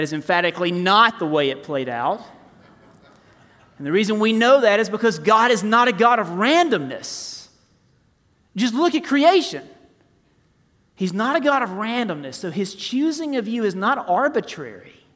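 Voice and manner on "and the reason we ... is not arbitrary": louder and higher, driving the point; forceful preaching. Real, not acted. Preacher driving home to the church that God isn't random. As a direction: preach it hard.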